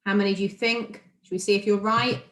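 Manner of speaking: normal talking voice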